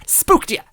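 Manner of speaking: very high, loud shrieking laugh; ghost shriek. Acted. Ghost scaring the narrator, shrieking laugh. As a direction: shriek and cackle in their face. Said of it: "shrieked into my face".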